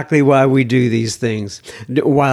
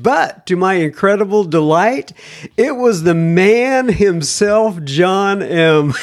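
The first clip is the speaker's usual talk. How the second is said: lightly raised reading voice; email read aloud; 5 semitones higher than usual. Acted. Host reads listener email to audience, lightly raised voice. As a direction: read the letter like you're quoting him.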